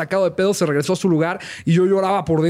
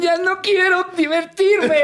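mock whiny voice; 12 semitones higher, slower than usual. Quoted line with laugh, high slow.

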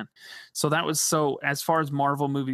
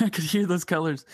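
laughing gas; 3 semitones higher than usual. Retold or imitated. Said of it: "high on laughing gas".

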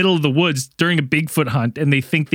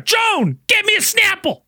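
much higher, bossy barked order to his wife; grumpy old man. Retold or imitated. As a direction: bark it at the wife off camera. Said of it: "he just turns off camera".

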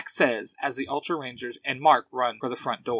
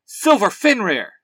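loud, raised-pitch battle cry; high, forceful hero shout; yelled morph call; 6 semitones higher, 7 dB louder than usual. A narrator's reading. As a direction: yell it like a transformation call. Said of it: "Cece yells".

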